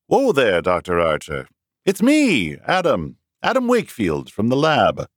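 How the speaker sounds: lightly voiced cheerful colleague; friendly man. A narrator's reading. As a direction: greet him cheerily. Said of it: "Wakefield greets Archer".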